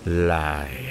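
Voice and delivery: low, slow lecherous drawl; slurring drunk landlord calling the servant girl; drunk master's leer. A narrator's reading. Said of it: "Ông lè nhẹ".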